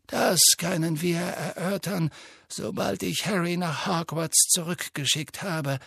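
put-on calm, measured old wizard; Dumbledore voice. A narrator's reading.

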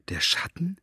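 breathless frightened whisper. A narrator's reading. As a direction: barely breathe the question. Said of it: "Maggies Stimme war kaum mehr als ein Flüstern".

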